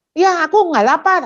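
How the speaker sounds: put-on high, loud childish protest; annoyed child. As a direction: snap back like a cross kid. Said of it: "Dia lagi kesel".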